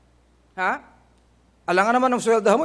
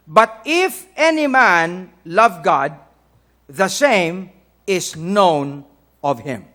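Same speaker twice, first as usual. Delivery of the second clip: slow, emphatic Bible reading; scripture reading; 4 semitones lower, 6 dB louder, much slower than usual. Acted. Preacher quoting Corinthians to the congregation, slow emphatic reading. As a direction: read each phrase out slowly and weightily. Reads scripture aloud slowly.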